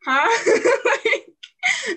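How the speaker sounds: squealing laugh, very high; giggling huh. Retold or imitated. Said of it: "I'll be like".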